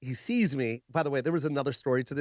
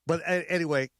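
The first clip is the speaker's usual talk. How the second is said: usual speaking voice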